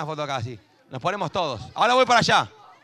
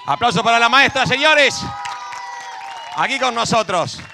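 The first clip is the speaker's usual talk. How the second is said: high, shouted MC introduction; rally the crowd to applaud; pedido de aplausos; 5 semitones higher, 5 dB louder than usual. Real, not acted. Pide aplausos para la maestra al público. (animador pidiendo aplausos a gritos)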